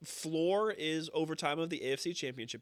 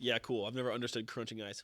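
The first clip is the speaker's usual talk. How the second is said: ordinary talk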